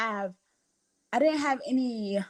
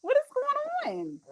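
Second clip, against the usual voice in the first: a much higher, softer bewildered voice; confused disbelief; 13 semitones higher, 4 dB quieter than usual. Retold or imitated. Recalling the clapping crowd, lightly acted bewilderment. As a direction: act baffled by the applause. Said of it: "I was like".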